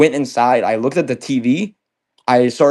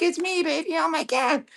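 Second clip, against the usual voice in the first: much higher, softer pleading woman's voice; girlfriend's voice; 5 dB quieter than usual. Retold or imitated. Girlfriend after being tackled, pleading to be recognised, high soft plea. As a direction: plead softly in a high voice so he knows you. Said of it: "And she's like".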